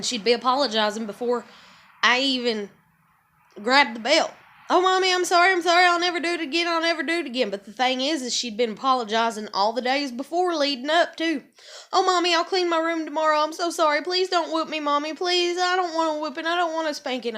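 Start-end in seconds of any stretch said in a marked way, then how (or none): 4.7-7.5 put-on higher, fast pleading kid voice; child pleading to her mom, fast and pitched up; imitating begging child. Voicing daughter begging before spanking. 11.9-17.4 put-on a pleading kid's voice, a touch higher; imitating begging child. Voicing daughter begging not whooped.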